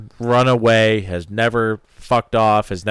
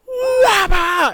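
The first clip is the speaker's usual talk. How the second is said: much higher, louder mock race engine wail; imitated engine revving; 4 dB louder than usual. Describing race cars on the backstretch, loud high mock engine howl. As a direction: belt out the engine noise like a car at full throttle. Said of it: "those things are going".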